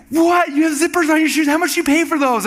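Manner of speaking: high, loud, flabbergasted outburst; astonished yelp, held high all through; mock shock. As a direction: imitate a stunned friend half-shouting questions. Acting Dan's shock at the zippers.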